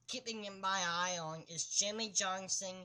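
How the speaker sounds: ordinary talk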